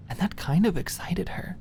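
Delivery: hushed, breathy whisper. A narrator's reading. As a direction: whisper it like a secret. Secret inner excitement.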